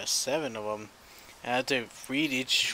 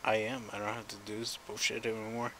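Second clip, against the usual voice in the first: ordinary delivery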